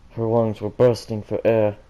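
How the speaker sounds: usual speaking voice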